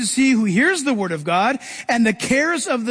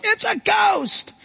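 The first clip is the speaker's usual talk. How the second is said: high, slow frightened cry; shouted in fear; 11 semitones higher, slower than usual. Retold or imitated. Disciples on the boat seeing a ghost, high slow scared shout. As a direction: cry out like terrified fishermen. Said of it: "That's why they shouted".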